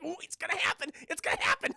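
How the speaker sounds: high, giddy squeal; so excited. Acted. Onlookers in the minivan, mock excited. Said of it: "They're so excited".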